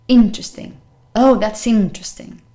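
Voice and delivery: interesting as IN-tresting, middle vowel gone; loud first syllable in interesting, no middle vowel; dropped middle vowel. Shown as a demonstration. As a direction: say interesting in three syllables, IN-tres-ting. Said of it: "So imagine it wasn't there".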